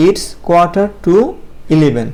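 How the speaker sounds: very slow it's quarter to eleven, each word drawn out; teacher repeating it's quarter to eleven slowly; slow model phrase. Shown as a demonstration. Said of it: "আমরা এটা এভাবে বলতে পারি".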